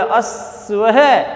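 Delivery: अश्व है with स said only half. Shown as a demonstration. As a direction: clip the स in अश्व short. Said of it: "आधा ही बोला".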